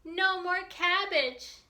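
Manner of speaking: loud, lively read-aloud with slow punchy refrains; reading to children. A narrator's reading. Teacher reading a picture book to children, lively and loud. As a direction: read it big and bouncy for the kids.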